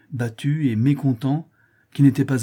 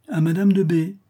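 cool repeat, pitched higher and held; coldly repeating; 6 semitones higher, 3 dB louder than usual. A narrator's reading. Baroness confirming her news to the startled company, coldly. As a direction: echo it back with cool assurance. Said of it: "répéta froidement la baronne".

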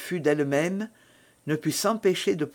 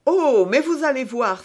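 raised pitch, loud opening "Oh"; eager exclamation; 7 semitones higher, 5 dB louder than usual. A narrator's reading. Novice exclaims to Milady about soon becoming acquainted, eager exclamation. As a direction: exclaim it keenly, higher and louder. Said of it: "s'écria la novice".

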